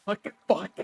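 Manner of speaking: ordinary delivery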